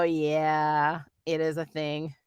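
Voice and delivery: normal talking voice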